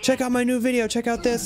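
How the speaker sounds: mock high spammer yell; all caps spam. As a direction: shout it like a pushy self-promoter. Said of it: "Like, the all caps".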